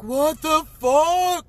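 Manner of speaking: loud, drawn-out stunned yell; shocked outcry. Retold or imitated. Fan retells the reaction to the movie moment, shocked outcry. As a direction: stretch it out in loud disbelief.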